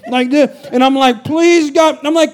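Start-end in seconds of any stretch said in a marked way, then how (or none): 1.2-2.0 high, pleading cry to God; panicked prayer. Retold or imitated. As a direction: beg God in terror. Retold panic on cliff drive.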